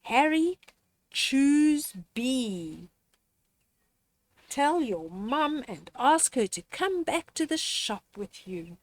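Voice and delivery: ordinary delivery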